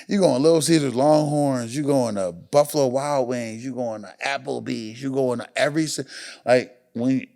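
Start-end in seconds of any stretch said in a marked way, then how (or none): none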